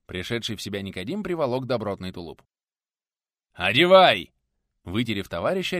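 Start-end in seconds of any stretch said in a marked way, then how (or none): 3.5-4.3 loud, sharp bark; barked order. A narrator's reading. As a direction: bark it at your comrade as an urgent command. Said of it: "рявкнул дружинник".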